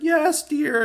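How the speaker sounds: high, mock-meek "Yes, dear." from a henpecked husband. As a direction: squeak out "Yes, dear." like a husband who never argues. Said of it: "bowing down and being a total eunuch".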